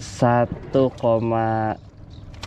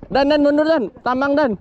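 calling out; 14 semitones higher, faster than usual. Real, not acted. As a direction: shout the name urgently. Calling friend to back off.

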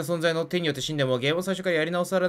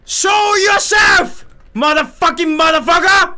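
high, slow, loud yelling at the empty room; frustrated high shout; 10 semitones higher, 8 dB louder, much slower than usual. Real, not acted. Streamer yelling at a game that ignores him, high shout. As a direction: scream it high to make something happen. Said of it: "こんなに叫んでるのに".

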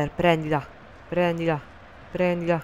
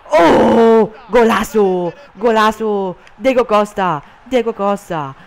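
ecstatic goal shout, much louder and higher; 5 semitones higher, 12 dB louder, slower than usual. Real, not acted. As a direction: roar the goal.

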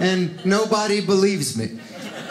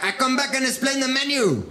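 higher, helpful Mexican waiter; mock waiter; 6 semitones higher than usual. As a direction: talk down kindly to a lone diner.

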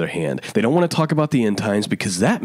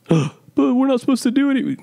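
much higher, mocking whiny objector voice. Preacher mocks Christians who resist obeying: high mocking voice. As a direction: parody a scandalized churchgoer.

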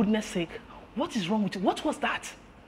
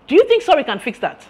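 raised, loud rebuke; angry scolding; 5 semitones higher, 7 dB louder than usual. Acted. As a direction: tear into the employee. Boss scolds employee for the scene.

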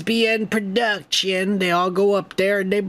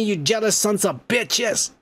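ordinary delivery

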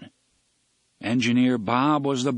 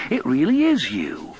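louder, amazed realisation; astonished; 2 semitones higher, 5 dB louder than usual. A narrator's reading. Engineer realising his train is alive, astonished. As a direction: let belief dawn with emphasis.

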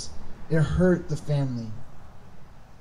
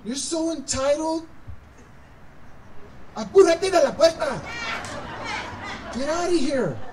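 angry father; 6 semitones higher than usual. Pretend. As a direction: pretend furious dad, pitched much higher, building up. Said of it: "I would have been like".

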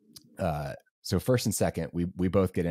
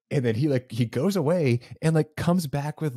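usual speaking voice

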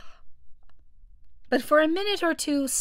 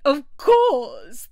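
loud, high exclamation; emphatic agreement; 4 semitones higher, 8 dB louder than usual. A narrator's reading. Mock Turtle agrees eagerly with the Griffin, emphatic cry. Excited creatures trade dance steps.

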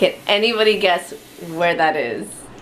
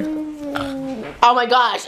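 drawn-out wow; 5 semitones higher, much slower than usual. Real, not acted. Tasting with baby.

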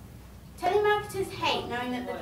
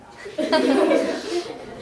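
laugh; 2 semitones higher, 9 dB louder than usual. Real, not acted. Laughing at own joke.

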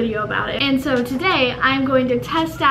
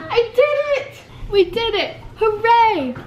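high triumphant cheer; 9 semitones higher than usual. Real, not acted. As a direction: cheer a small victory up high. Celebrating buttoning the onesie.

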